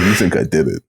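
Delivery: low, soft, menacing question; O J Simpson impression. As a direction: drop deep and quiet, pointing at them. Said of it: "he was just like".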